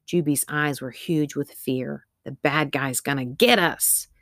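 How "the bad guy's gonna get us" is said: ordinary delivery, nothing marked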